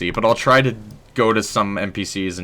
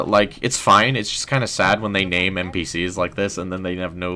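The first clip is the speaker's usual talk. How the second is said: ordinary talk, nothing marked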